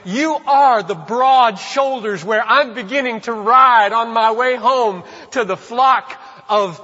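slow, high, loud praise; fervent worship cry. Acted. Worshipper praising the shepherd, slow, high and loud. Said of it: "they begin to say".

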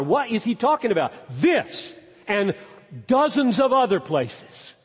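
loud, high scripture proclamation; impassioned preacher driving the point home; full-force preaching. Real, not acted. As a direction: declaim the verses with ringing emphasis.

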